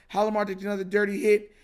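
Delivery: normal talking voice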